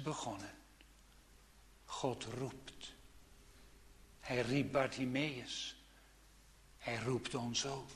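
soft, intimate sermon voice; hushed preaching. Acted. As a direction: speak gently, almost whispering. (zacht, ingetogen preekstem)

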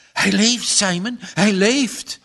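high, loud, joyful relief; mother crying out to her husband in relief; relieved cry. Acted. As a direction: cry out that he lives. Mother hears son groan.

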